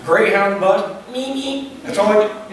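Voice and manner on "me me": mock tiny horn beep, pitched up and soft; weak car horn. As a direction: squeak like a puny horn. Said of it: "But the horn, really?".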